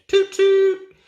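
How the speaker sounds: very high, loud whistle toot; train whistle toot. Acted. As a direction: toot like a steam engine whistle.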